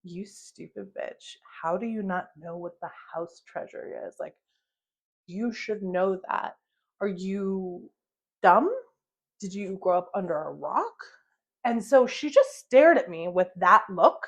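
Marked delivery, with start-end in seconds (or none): 0.0-11.0 withering disdain. Retold or imitated. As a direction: say it softly, as if they are painfully stupid. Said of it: "that painful look that someone gives you".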